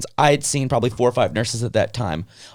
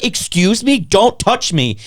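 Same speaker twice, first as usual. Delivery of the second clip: retold indignation; 6 semitones higher, 3 dB louder, slower than usual. Patient objecting to nurse poking legs.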